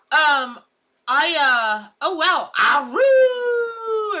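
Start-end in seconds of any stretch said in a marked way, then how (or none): 2.6-4.2 high, loud cried-out howl; wolf howl. Acted. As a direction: throw your head back and howl. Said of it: "Everybody give me a aru".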